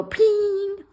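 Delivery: high, loud, drawn-out "ping"; imitated ping. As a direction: ring it out like a sound effect. Acts out brain pressure noise.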